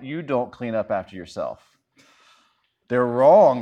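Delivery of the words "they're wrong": loud verdict. Pretend. Mock verdict on roommate.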